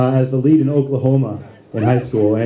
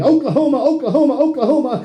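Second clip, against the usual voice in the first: mock yell; 10 semitones higher than usual. Imitating Ruprecht hopping round the Riviera, mock yelling. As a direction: chant it high in an imitated yell.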